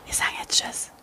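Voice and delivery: secretive whispered goodbye; conspiratorial whisper. Pretend. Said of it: "Warum flüsterst du?".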